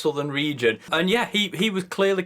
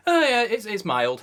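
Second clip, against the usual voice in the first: a bit delighted; 7 semitones higher than usual. Shown as a demonstration. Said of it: "he's a bit delighted".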